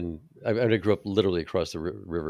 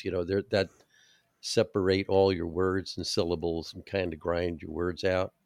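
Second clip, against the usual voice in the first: ordinary talk, nothing marked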